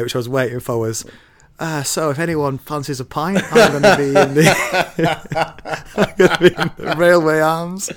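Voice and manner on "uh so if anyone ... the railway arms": laughing through an imagined announcement; giggling while doing the conductor. Retold or imitated. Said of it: "the only thing he didn't say".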